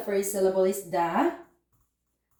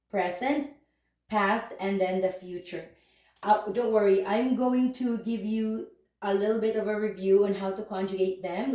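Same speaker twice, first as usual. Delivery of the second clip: ordinary talk, nothing marked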